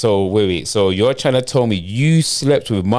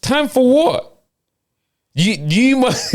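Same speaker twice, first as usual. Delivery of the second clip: much higher, slow outraged question; angry disbelief; 13 semitones higher, slower than usual. Acted. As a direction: fire it back in disbelief. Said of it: "the way I'm angry".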